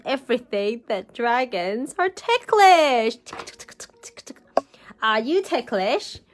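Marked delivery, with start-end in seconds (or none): none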